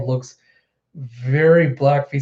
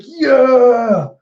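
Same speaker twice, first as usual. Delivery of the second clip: high, loud crowd yell; rallying cheer; 8 semitones higher, 7 dB louder than usual. Retold or imitated. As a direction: cheer it out like a fired-up crew. Crowd cheers in retold scene.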